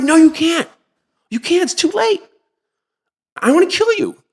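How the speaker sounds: shrill, near-shouted death threat; high furious threat. Retold or imitated. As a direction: tell him it's too late, furious. Threatening the adviser.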